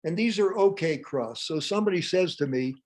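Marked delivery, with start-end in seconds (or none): none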